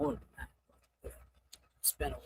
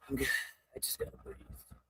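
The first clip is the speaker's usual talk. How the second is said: breathy calming whisper; 5 semitones lower, 11 dB quieter, much faster than usual. Real, not acted. Overwhelmed, calming down.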